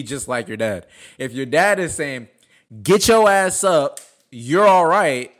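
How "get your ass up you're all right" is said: slow, louder stern father order; stern dad voice. Retold or imitated. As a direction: bark it like a strict dad. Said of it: "in that stern dad voice".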